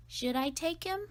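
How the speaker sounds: ordinary delivery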